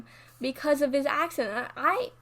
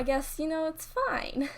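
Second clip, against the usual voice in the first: airy, drawn-out and higher, brushing it off; mock breezy shrug; 6 semitones higher, slower than usual. Real, not acted. Looking back on rough middle school, airy and dismissive. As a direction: shrug it off lightly, like it doesn't matter.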